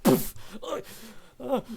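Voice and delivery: loud puff, then short yelps; acted tumble with cries of fright; startled falling yelps. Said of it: "he falls backwards on his chair".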